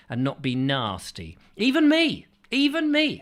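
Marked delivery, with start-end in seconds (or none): 1.6-2.3 high-pitched. Pretend. Self-mocking high repeat. 2.5-3.2 high-pitched. Pretend. Self-mocking high repeat.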